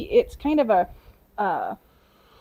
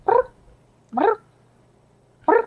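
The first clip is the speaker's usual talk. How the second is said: cat trill; 10 semitones higher, 3 dB louder than usual. Retold or imitated. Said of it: "and he'll go".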